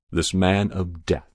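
usual speaking voice

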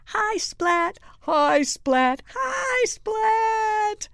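put-on loud, high chorus of kids; eager pupils' shouted hellos; class greeting. A narrator's reading. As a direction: call out hello, climbing to a squeal. Whole class greets new pupil.

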